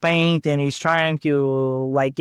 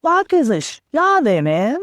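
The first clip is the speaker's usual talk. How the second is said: baffled outburst; 8 semitones higher than usual. Retold or imitated. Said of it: "And I'm like".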